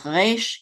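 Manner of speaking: "Resh" with the R back in the throat. Shown as a demonstration. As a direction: say "Resh" with a guttural French-style R. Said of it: "in the back of the throat like that".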